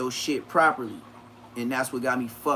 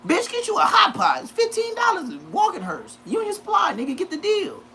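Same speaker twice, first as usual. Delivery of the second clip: mock yell. Yelling advice at inmates.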